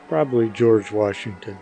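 ordinary delivery

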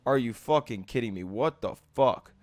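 quiet, low, fast exasperation; low seething. Real, not acted. Player mad at another's move, low seething. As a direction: drop it low and soft, fed up.

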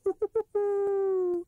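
high, loud goblin-like owl chuckle. A narrator's reading. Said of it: "He chuckled in a goblin way".

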